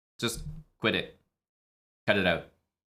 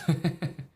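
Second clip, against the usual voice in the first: short laugh; 5 semitones higher than usual. Real, not acted. Laughing at dumb engine moves.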